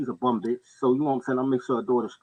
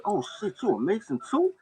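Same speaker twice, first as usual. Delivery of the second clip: surprised cousin; 10 semitones higher, 4 dB quieter than usual. Retold or imitated. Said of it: "He said".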